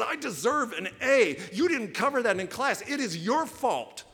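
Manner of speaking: high, loud demanding complaint; angry student. Acted. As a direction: accuse the professor at full volume. Student confronts professor angrily.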